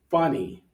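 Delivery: FUNny, slow, weight on the first syllable; first-syllable stress. Shown as a demonstration. Teacher sorts words by stress, first syllable of funny stressed. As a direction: stretch and stress the start of funny. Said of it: "first syllable".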